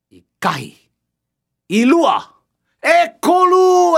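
building count, ending in a long shout; race countdown. Acted. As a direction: call the start, stretch the last number. Referee counting to start race.

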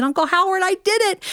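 high-pitched mock triumph; retold childlike excitement; excited announcement. Retold child's triumphant call to uncle.

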